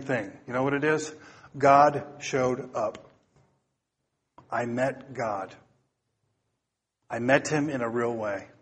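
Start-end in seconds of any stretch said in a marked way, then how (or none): 1.6-8.5 slow, low, soft testimony; hushed awe. Retold or imitated. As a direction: let it sink in, quietly and reverently. Quoting awed testimonies of meeting God.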